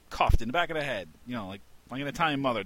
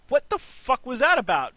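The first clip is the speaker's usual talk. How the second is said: much higher, louder startled protest; indignant outcry; 12 semitones higher, 6 dB louder than usual. Retold or imitated. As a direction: yelp after getting smacked. Smacked by mom, protesting.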